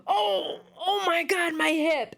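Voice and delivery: high, hammed-up cry of pain over a bumped hip; mock pained whine. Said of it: "and he's like".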